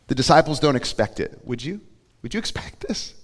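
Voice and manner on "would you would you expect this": whispered aside. Real, not acted. Rhetorical aside to congregation.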